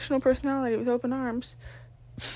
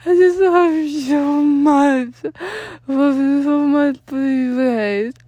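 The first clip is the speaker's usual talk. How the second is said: slow, loud, higher sobbing plea; 4 semitones higher, 10 dB louder, much slower than usual. Acted. Danny sobbing his love while clinging to friends. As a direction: wail it through the tears. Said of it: "sudden need to cry".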